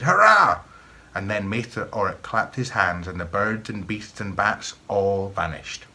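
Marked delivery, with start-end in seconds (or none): 0.0-0.6 put-on high, loud boyish cheer; excited shout. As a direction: burst out in delight. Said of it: "he shouted".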